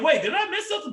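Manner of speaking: very high-pitched baffled question; confused. Retold or imitated. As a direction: squeak out your mock confusion. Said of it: "Okay, I was confused".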